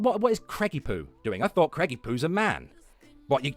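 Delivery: ordinary talk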